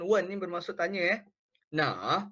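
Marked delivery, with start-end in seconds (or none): 1.7-2.2 "Na" dipped low in the third tone. Shown as a demonstration. Teacher showing the class the third tone on "Na". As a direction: drop "Na" down low and let it dip. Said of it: "Nada yang ketiga".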